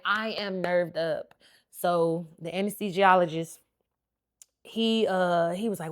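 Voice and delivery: usual speaking voice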